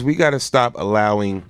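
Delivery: ordinary talk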